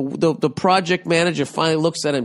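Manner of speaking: ordinary delivery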